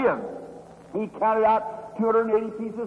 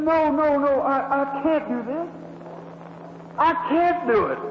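mild tearful woman; 4 semitones higher than usual. Retold or imitated. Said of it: "she said".

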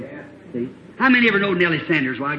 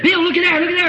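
frightened cry; 12 semitones higher, 5 dB louder, faster than usual. Retold or imitated. Frightened girl points.

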